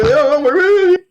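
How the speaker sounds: drawn-out, high caricature of mom; exaggerated parental whine; mimicked voice. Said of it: "whenever I describe people's voice".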